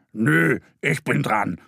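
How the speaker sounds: lightly voiced stubborn boar, slowed. Boar refusing to let Mo pass, stubborn. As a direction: dig in your heels as the boar.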